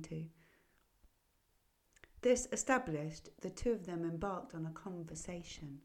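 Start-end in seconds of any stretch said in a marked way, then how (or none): none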